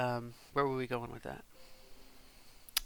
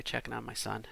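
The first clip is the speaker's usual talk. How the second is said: usual speaking voice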